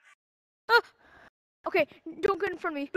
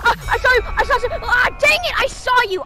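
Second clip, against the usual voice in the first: loud, high excited shouts; excited yelling; 6 semitones higher, 7 dB louder, faster than usual. Real, not acted. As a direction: yell it as you spot them. Spotting enemy in game.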